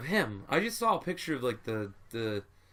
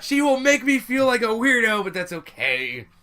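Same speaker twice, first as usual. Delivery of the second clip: excited; 6 semitones higher, 9 dB louder than usual. Pretend. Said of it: "he's like".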